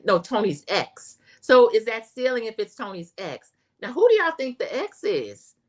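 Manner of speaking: normal talking voice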